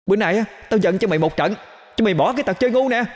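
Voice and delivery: high, rapid-fire angry scolding; furious threat. Acted. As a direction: threaten payback while chasing him. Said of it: "khiến con Thơm tức cành hông". (tức giận hăm dọa, giọng the thé)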